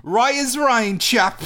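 high, bright shout of an English chap; cheery English chap. Pretend. Schoolboy cheerily answering the soldier with a high shout. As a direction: call out cheerily like a posh lad. Acted skit, cheery reply.

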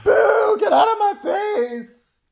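very high, drawn-out jeering shout; mock heckling. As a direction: boo and shout them off the stage. Booing the retold sweet talker.

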